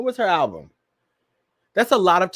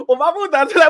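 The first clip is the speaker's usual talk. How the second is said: high, loud parody of mumbled lyric; mocking the lyric; 10 semitones higher, 4 dB louder, faster than usual. Host parroting a garbled [name] lyric, high and loud. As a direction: repeat the garbled line mockingly. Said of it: "Let me hear that again".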